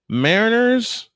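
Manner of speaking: normal talking voice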